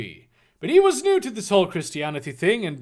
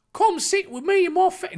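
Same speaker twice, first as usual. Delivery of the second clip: mock Cockney; 12 semitones higher than usual. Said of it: "this is now Cockney".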